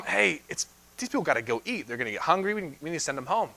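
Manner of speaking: softly voiced worried disciples, hushed; quiet mock disciples. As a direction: murmur it like nervous followers. Disciples voiced telling their rabbi.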